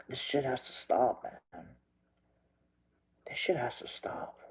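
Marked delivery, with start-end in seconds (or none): 3.3-4.4 whispered, much softer, voice dropping away; hushed whisper. Real, not acted. Repeating his lament to viewers, now whispered. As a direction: let it fall to a whisper.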